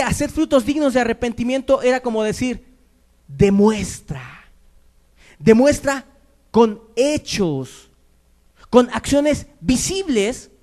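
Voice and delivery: loud, high-pitched pulpit proclamation; fervent preaching. Acted. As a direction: drive each phrase home to the congregation, slowly. Preaches at full force.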